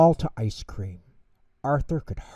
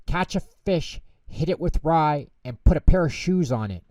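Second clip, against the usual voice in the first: a louder, higher waitress call, speeding up; shouting an order; 6 semitones higher, 5 dB louder than usual. A narrator's reading. Waitress calling the order to the cook, louder. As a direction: bark the order to the kitchen. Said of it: "The waitress shouted Santa's order".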